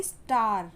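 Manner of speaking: "स्टार" with a drawn-out aah, falling; slow, clear "स्टार"; long A demo. Shown as a demonstration. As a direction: stretch the vowel in "स्टार". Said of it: "long sound".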